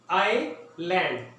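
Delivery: आइलैंड drawn out slowly, silent s; slow model word. Shown as a demonstration. Teacher models the word for students, slow. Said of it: "teacher repeating the word slowly".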